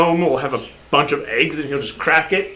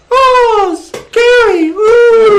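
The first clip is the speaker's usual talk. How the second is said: very high, loud swooning coo; swooning fans; 7 dB louder, much slower than usual. Acted. Girl gnomes in the front row swooning over Gary, very high coo. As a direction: swoon like smitten fangirls. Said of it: "they just start going".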